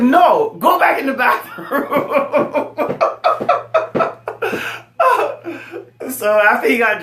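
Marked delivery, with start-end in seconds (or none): 0.0-2.7 much higher, loud, slowed laughing yell; laughing mock shout. Sending his brother back to the bathroom, laughing mock shout. 2.8-6.0 airy high-pitched laughing fit; breathless high laugh. Real, not acted. Laughing at the memory of his brother, breathless high laugh. Laughs after retold shout.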